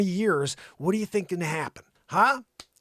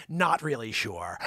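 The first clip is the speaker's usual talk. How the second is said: Connecticut lockjaw; 9 semitones lower, slower than usual. Retold or imitated. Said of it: "a Connecticut lockjaw thing".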